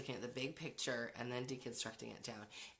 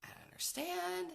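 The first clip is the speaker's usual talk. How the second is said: put-on pitched way up as the baffled teenage daughter; imitating teen daughter. Mom mimics her daughter being fed up with catty girls. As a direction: play the daughter, exasperated and puzzled. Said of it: "She's like".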